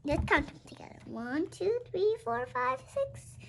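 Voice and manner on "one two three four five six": counting up. Shown as a demonstration. Counting together with children.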